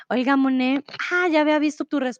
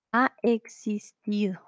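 ha existido whispered slowly; 3 semitones lower, 4 dB quieter than usual. Shown as a demonstration. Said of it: "sino el A".

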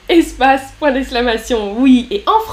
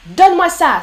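a commanding demand, higher and louder; order; 5 semitones higher, 5 dB louder than usual. Shown as a demonstration. Teacher voicing a chat order to the class. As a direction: bark it like an order. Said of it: "Ça, c'est un ordre".

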